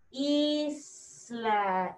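Isla in slow, separated syllables; drawn-out word sounding. Shown as a demonstration. Teacher revealing the answer to her class, drawn-out word sounding. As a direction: stretch out "Isla", long i, then la. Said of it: "Empieza con la letra".

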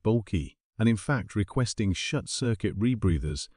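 usual speaking voice

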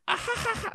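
imitated high-pitched giggle; mock children's laughter; kids laughing. Mimics kids laughing at swear word.

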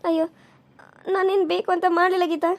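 normal talking voice

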